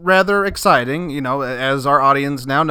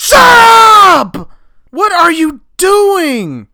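alarmed shout; 9 dB louder, much slower than usual. Acted. Host stops D A yelling.

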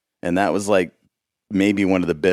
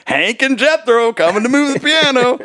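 hillbilly voice; 5 dB louder than usual. Retold or imitated. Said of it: "the Hee Haw guy".

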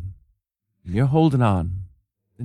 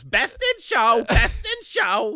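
slow, high, drawn-out ghost voice; eerie dead Eugene Levy moaning the title; ghostly Eugene Levy; 12 semitones higher, much slower than usual. Retold or imitated. Friend imitates a dead Eugene Levy, slow high ghostly chant. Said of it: "a dead Eugene Levy pops up and goes".

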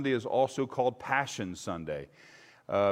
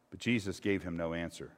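ordinary talk, nothing marked

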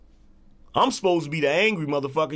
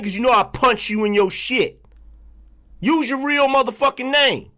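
loud, higher, furious yelling at trolls; angry rant; 6 semitones higher, 4 dB louder than usual. Real, not acted. Streamer yelling at trolls hiding behind fake names, furious and loud. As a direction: shout them down, fed up and heated.

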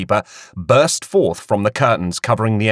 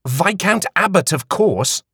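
condescending; 9 semitones higher than usual. A narrator's reading. Queenie informing with condescension.

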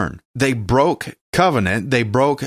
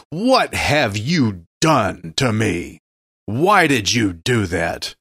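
mock accusing God; 3 semitones lower, slower than usual. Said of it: "we shake our fist at God and we go".

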